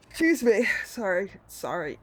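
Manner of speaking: words pushed out through a yawn. Real, not acted. Sorry to the viewers while yawning loudly. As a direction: apologise mid-yawn, loud. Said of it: "With the yawns".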